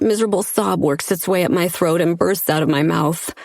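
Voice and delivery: usual speaking voice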